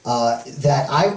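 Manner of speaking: ordinary talk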